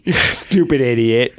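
slow, drawn-out mock put-down; mock-disapproving kid insult; imitating scornful daughter. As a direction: stretch it out with childish disdain. Voicing daughter's scorn for dad.

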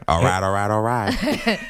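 slack Texan drawl, a Matthew McConaughey nod; lazy Southern drawl. Pretend. A hint of a Southern Matthew McConaughey. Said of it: "Did you do a Southern accent?".

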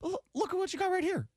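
high-pitched put-on voice; character voice. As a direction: pitch way up in a goofy voice. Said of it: "Joe is turning into, uh, into voice guy".

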